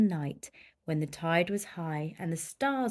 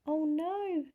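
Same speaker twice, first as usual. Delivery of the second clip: dismayed; 8 semitones higher, 5 dB quieter than usual. A narrator's reading. Tide leaves whale.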